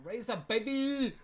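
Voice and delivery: slow, drawn-out hype; excited cheer. Real, not acted. Reacting to Kelly's new phone, with drawn-out hype. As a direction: savour the phone name.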